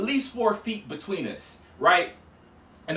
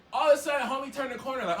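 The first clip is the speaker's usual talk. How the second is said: ordinary delivery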